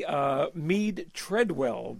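spelled out slowly, name by name; slow down so the name can be written; slow for dictation. Real, not acted. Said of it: "Let me get that down again".

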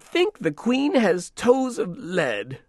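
high, slow grumbling dame; old woman voice. Acted. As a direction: play a sharp-tongued old dame.

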